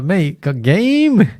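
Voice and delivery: slow, high, swaggering boast; cocky brag. Retold or imitated. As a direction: drag it out like a smug show-off. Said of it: "I was like".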